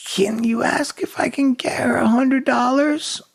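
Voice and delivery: high, slow, childlike put-on plea. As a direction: ask helplessly in a faked impaired voice.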